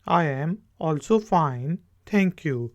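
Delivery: slow, clear repeat; slow demo. Shown as a demonstration. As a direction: model it slowly for learners. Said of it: "तो आगे से आप जवाब क्या देंगे?". (धीमा दोहराव)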